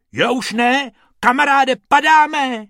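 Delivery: high and loud, falling; panicked high call; alarmed cry. A narrator's reading. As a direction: shout it as you tumble down. Falling from the sky in hat.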